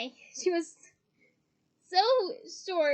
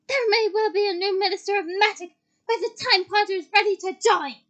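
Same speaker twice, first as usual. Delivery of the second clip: full-voiced, higher-pitched angry shout; 4 semitones higher, 11 dB louder than usual. A narrator's reading. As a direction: shout the retort at full volume. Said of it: "shouted Professor McGonagall".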